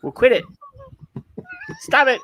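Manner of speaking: high, loud mock outrage; mock scolding. Host tells fidgeting co-host off, mock exasperated.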